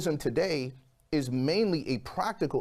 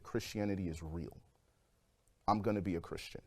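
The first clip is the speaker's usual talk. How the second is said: hushed awe; 6 semitones lower, 8 dB quieter than usual. Retold or imitated. Alone on bed, awed realization.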